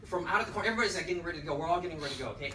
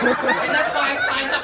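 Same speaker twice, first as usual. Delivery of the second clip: higher, louder and slow, laughing through it; laughing quote; 9 semitones higher, 10 dB louder than usual. Retold or imitated. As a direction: deliver her excuse while cracking up. Retelling colleague's reasoning, laughing.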